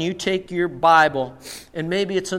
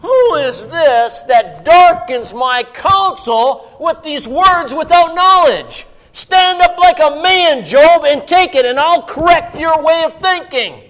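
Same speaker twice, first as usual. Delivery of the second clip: thundering, high, loud rebuke; commanding divine reprimand, fully acted; stern God; 8 semitones higher, 9 dB louder than usual. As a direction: boom it out like God confronting a man. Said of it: "But God spoke very sternly".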